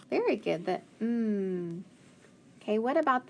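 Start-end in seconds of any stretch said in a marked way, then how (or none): 1.0-1.8 "mm" as a held hum; isolated sound. Shown as a demonstration. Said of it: "the last sound in tame".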